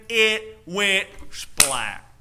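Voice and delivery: slow, drawn-out it went splat, like a collapse. Acted. Preacher to congregation, acting out the song, slow drawn-out splat. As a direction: let the house go splat slowly.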